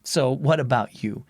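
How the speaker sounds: ordinary delivery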